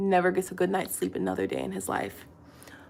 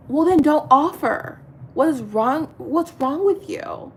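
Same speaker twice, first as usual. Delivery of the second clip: louder, higher, slowing on the last question; retold exasperation; 6 semitones higher, 5 dB louder than usual. Woman retelling how she scolded boyfriend, heated. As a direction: let him have it.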